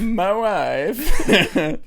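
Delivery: high, loud catchphrase imitation with laughter; Borat. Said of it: "I was really excited for Borat".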